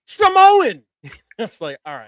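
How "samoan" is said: sudden loud commentator yell; Cole's shout. Retold or imitated. Podcaster mimicking the commentator's call, shouting. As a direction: belt it like a ringside announcer. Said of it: "and Michael Cole goes".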